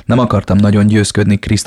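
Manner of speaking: usual speaking voice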